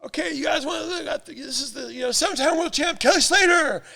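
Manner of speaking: stage announcer. Retold or imitated. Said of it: "Eddie goes".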